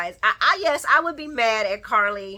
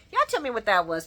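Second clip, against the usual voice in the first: ordinary talk, nothing marked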